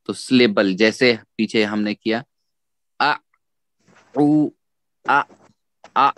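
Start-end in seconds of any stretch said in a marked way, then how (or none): 3.0-6.1 "आ, उ, आ" as isolated sounds with pauses; separated sounds. Shown as a demonstration. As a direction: break आ... उ... आ cleanly apart. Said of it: "अलग किया हमने". (आ, उ, आ अलग-अलग, रुक-रुक कर)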